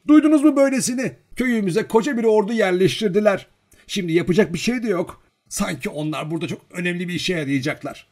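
put-on high, loud old village woman butting in; indignant old woman.

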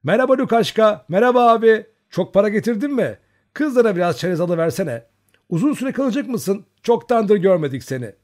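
put-on a crowd of merry girls shouting greetings; cheerful girls. A narrator's reading. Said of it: "neşeli sesler yükseldi". (neşeli kızlar hep bir ağızdan, yüksek ve tiz)

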